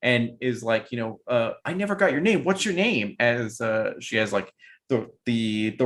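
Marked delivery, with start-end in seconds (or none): 1.6-3.1 put-on Belle, amnesiac girl. GM voices Belle meeting party.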